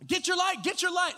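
high panicked shout at the brother-in-law; panicked yell. Retold or imitated. Pastor yelling in panic at his brother-in-law in the tent. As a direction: yell it in a panic, pitch shooting up. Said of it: "I'm yelling at my brother-in-law".

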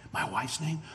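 soft whisper, no voice at all; whispered reminder. Retold or imitated. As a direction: lean in and breathe it. Man reminds pastor of wife.

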